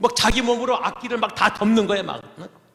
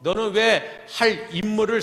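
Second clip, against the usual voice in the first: usual speaking voice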